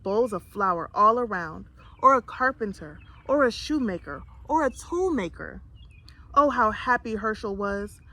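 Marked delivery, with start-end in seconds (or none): none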